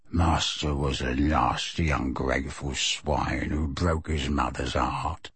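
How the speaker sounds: low, soft, slow elf grumble; elf undertone. A narrator's reading. The elf insulting Sirius under his breath, low. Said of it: "continuing in an undertone".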